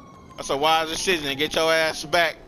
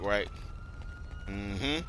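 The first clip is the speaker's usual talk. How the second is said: ordinary talk, nothing marked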